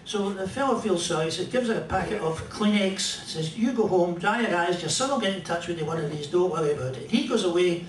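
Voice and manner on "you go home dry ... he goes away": ordinary talk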